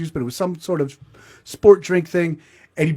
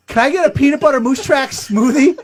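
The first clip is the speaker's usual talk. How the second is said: eager order; 9 semitones higher, 6 dB louder than usual. Retold or imitated.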